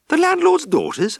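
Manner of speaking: high, loud disbelieving cry; you can't believe your ears; incredulous. A narrator's reading. Vet astonished at the farmer's claim, incredulous.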